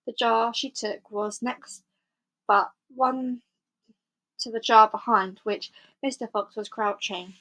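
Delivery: usual speaking voice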